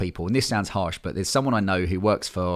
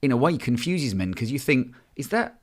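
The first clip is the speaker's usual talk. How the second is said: mock confused man; 7 semitones higher than usual. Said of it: "I was like".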